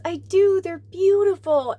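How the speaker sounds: put-on high, loud, slow little girl in awe; small child gushing over fairy wings. Voicing the five-year-old girl.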